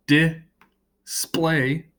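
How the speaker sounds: display split slowly with a gap, di... splay; display pulled apart into two syllables. Shown as a demonstration. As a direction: say display as two high, separated beats. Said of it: "has two syllables".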